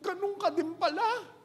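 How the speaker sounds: second widow confessing to her friend; hammed-up weeping. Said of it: "iyakan silang dalawa".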